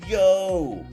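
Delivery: loud, bright "yo" at a donation; excited shout. Real, not acted. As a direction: call out in surprise. Reacting to a gift.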